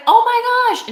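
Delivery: high, loud delighted gasp of recognition; surprised exclamation. Retold or imitated. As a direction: blurt it out, thrilled to see her. Running into an old friend.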